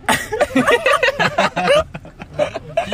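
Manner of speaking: high shrieking laugh; hysterical laugh. Real, not acted. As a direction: breaks into a high laugh fit. Teasing Ryan.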